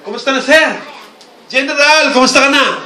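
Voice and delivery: loud, high cheerful hello to a general; mock hearty greeting. Son greeting his father the general, loud and hearty. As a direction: greet him boldly like a general. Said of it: "Father ko, ang tawag sa kanya".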